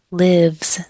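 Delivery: ordinary talk, nothing marked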